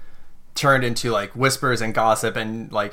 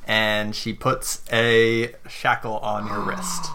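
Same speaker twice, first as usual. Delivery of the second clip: ordinary talk, nothing marked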